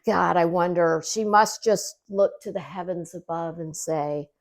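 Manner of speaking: ordinary delivery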